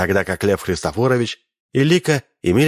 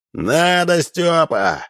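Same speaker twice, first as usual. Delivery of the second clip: chorus shout; 9 semitones higher, much slower than usual. A narrator's reading. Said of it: "был ответом хоровой крик".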